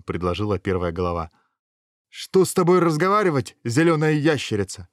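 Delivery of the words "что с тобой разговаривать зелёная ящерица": high, loud indignant outburst; loud scornful retort. A narrator's reading. Hero refusing to talk with the lizard, loud scorn. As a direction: throw it back loudly.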